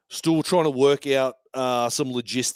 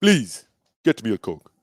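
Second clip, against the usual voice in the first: put-on African accent, falling at the end; playful Nigerian-style accent; 3 semitones lower than usual. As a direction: ask for a drink in a mock African accent. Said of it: "Rate my African accent".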